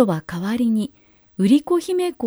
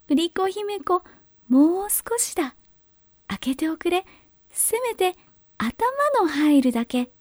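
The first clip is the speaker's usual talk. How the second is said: fake cute pouty voice; 6 semitones higher than usual. A narrator's reading. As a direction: coax her to open the door. Ogre coaxing further.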